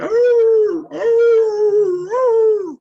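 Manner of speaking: loud, high whining dog moans; dog moaning imitation. Imitating the neighbor's dog stuck on a nail, loud high moans. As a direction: cry out like a hurt dog on a nail. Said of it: "he's moaning".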